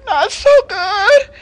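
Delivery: high, slow, whiny moan; milk the pity like a fake invalid; feigned sick lion. Lion fakes being sick.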